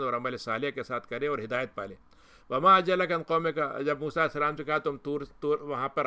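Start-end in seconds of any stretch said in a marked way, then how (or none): 2.5-3.6 slow, louder Arabic recitation; Quran recitation. Acted. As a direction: recite the verse slowly and fully. Quran verse recited within talk. (آہستہ، بلند آواز میں تلاوت)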